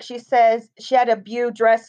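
ordinary talk, nothing marked

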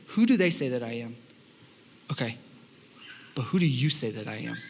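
a hint of Jesus asking gently; soft earnest Jesus. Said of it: "He says".

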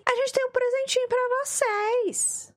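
high, sugary corporate announcement; mock company voice. Twitch Japan offering partners a gift, high sugary mock voice. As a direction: play the sweet company rep. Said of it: "e falou assim".

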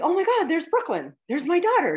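half-relived sudden delight, voice jumps up; seeing someone you love from afar, a touch acted; surprised exclaim. Spotting her daughter in meditation.

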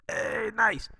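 high, drawn-out upselling waiter; waiter imitation. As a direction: put on a high-pitched waiter's sales voice.

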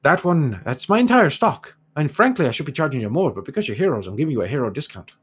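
put-on haggling dwarf merchant in an Irish accent; dwarf shopkeeper in an Irish accent haggling with the heroes. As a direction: bargain in a lilting Irish dwarf voice.

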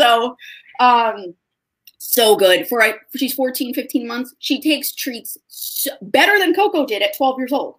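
normal talking voice

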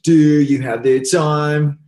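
sung high and loud in a put-on British punk accent, slowly. Showing the interviewer the Green Day singer's style, a sung mock British punk accent. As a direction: belt it like a punk frontman faking a British accent. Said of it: "sounds like they have a British accent".

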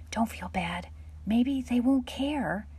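plain reading voice

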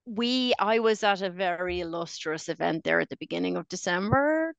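ordinary talk, nothing marked

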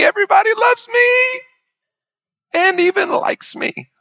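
high, sing-song, over-the-top contentment; mock blissful gushing. Pastor mocking a carefree person's bedtime prayer, gushing. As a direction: gush like everything is perfect.

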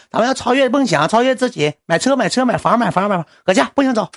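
high-pitched, scolding, nagging woman's voice; nagging assistant. Retold or imitated. Assistant scolding the streamer for going out, high nagging voice. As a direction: nag him to stay home and work.

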